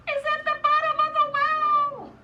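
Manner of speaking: shouted climax on one high note; urgent child's holler; high loud cry. Acted. Chang's climax to mother.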